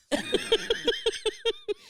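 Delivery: loud, high burst of laughter; real high-pitched cackling fit; helpless laughter. Real, not acted. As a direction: crack up loudly, high and pulsing. Cracking up over the Grimace photo.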